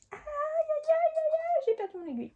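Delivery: high, squeaky aïe aïe of alarm; mock dismay. Losing her needle mid-stitch, high mock alarm. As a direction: yelp it like a cartoon panic.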